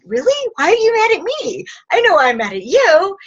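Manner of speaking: high, fast curious question; spring up in surprised curiosity; curious surprise. Shown as a demonstration. Answering a partner's anger with curious surprise, high and quick.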